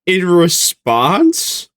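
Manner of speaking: slow, squealed incredulity; high-pitched disbelief. Real, not acted. Streamer reacting to drama video, high squealed disbelief. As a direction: stretch it out way up high, aghast.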